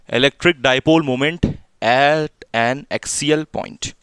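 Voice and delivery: slow, word-by-word dictation; drawn-out words, paced for note-taking; dictating. Real, not acted. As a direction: dictate a heading with long gaps. Dictating heading for students to write.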